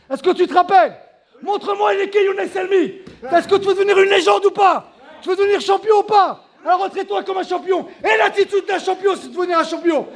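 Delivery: high, forceful coach's shout; shouted pep talk. Real, not acted. Coach demanding a champion's attitude from fighter, shouting. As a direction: fire him up at the top of your voice.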